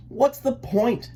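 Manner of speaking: high, slow little duckling voice; Elmer, slowly and high; whiny duckling. A narrator's reading. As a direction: ask it like a sulky small boy. Elmer asks.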